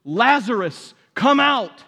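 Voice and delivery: Jesus calls Lazarus out of the tomb, shouting; loud cry. A narrator's reading. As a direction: call him out of the tomb at full voice. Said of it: "he cried out in a loud voice".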